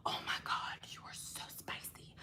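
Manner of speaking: very soft, confiding whisper; hushed whisper. Real, not acted. Streamer to viewer with neighbouring birthday, hushed whisper. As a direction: drop to an awed whisper.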